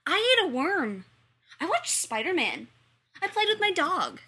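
high, loud preschooler voice; excited children. Acted. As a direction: blurt it out like an eager kid. Children shout answers in class.

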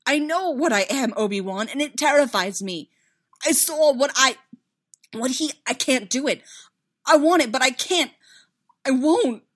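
high, loud, halting desperation; terrified outburst. A narrator's reading. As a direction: keep shouting in fear, breaking off mid-thought. Terrified outburst continues after shout.

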